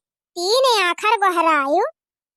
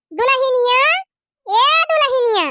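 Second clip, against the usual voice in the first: calling out; 5 semitones higher, 4 dB louder than usual. Acted. Mother-in-law calls daughter-in-law from afar.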